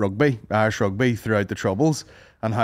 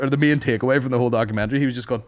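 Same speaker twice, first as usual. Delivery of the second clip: ordinary talk, nothing marked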